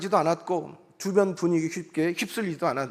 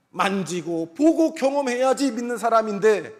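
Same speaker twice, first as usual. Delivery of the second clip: higher, louder imploring cry to God; fervent prayer, voice lifted high; earnest pleading prayer; 6 semitones higher, 4 dB louder than usual. Retold or imitated. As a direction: plead with God as Thomas, longing to believe. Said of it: "아마 간절히 기도했을 겁니다".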